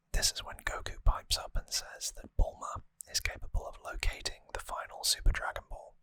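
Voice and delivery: ordinary talk, nothing marked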